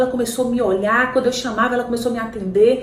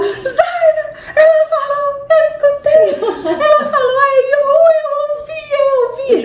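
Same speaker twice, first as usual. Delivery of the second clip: high, loud tearful half-sung cry; husband crying; 7 dB louder, slower than usual. Retold or imitated. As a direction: sob out the joy, slowly. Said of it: "Ele começou a chorar". (marido chorando de alegria)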